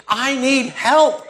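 loud, very slow cry of I need help; desperate plea. Retold or imitated.

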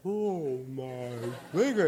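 drawn-out slow-motion replay voice. Retold or imitated. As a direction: stretch each word out as if in slow motion. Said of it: "I see it in slow motion".

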